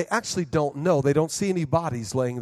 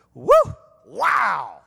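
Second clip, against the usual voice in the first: excited whoop; 4 semitones higher, 8 dB louder than usual. Retold or imitated. Caffeine rush hits.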